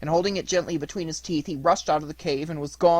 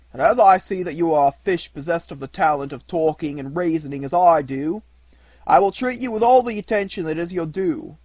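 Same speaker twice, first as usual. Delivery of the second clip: fisherman's voice; 3 semitones higher, 7 dB louder than usual. Acted.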